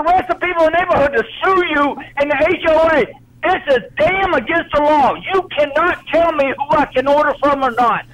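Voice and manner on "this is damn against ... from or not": normal talking voice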